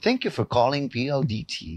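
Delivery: soft recorded hotline greeting; one-intonation phone greeting. Shown as a demonstration. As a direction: read it like a repetitive automated operator. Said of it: "iisa ang intonation yan".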